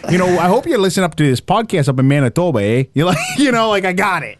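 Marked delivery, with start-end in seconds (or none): 0.1-2.8 friendly, hammed-up Canadian; Canadian accent. Shown as a demonstration. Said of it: "if I could do one accent, it is the Canadian".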